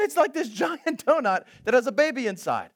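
high, loud, breathless rattling explanation; excited friend. Retold or imitated. New Orleans friend explaining Fat Tuesday, high and excited. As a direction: gush it out like it's your holiday.

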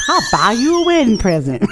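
hammed-up Southern accent, loud with laughter; loud mocking Southern accent. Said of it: "drifts into this Southern accent".

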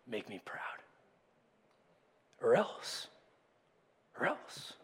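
low, soft, near-whispered threat; ominous hush. Retold or imitated. God looking down at us, quietly threatening. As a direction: let the warning hang quietly, menacing. God imagined as demanding parent.

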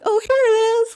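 put-on very high, pleased little girl; little girl finding her bunny in the car; sudden child discovery. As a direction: chirp it like a kid who found her toy. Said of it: "she's like".

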